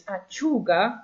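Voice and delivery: "Acciuga" as ah-CHOO-ga, U stressed. Shown as a demonstration. Teacher repeating "Acciuga" for learners, stress on CHOO. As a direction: lean on the U in "Acciuga". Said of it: "your U is where the stress is".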